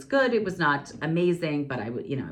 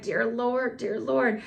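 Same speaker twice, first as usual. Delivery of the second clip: ordinary talk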